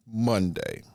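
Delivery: ordinary delivery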